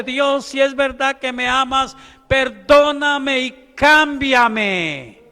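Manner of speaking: loud, high preacher's re-enactment of a plea; imitated tearful plea. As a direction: cry out to God, stretching the last words slowly. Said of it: "estaba llorando y diciéndole".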